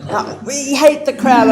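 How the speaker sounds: laughing repeat. Retold or imitated. Aunties repeat themselves.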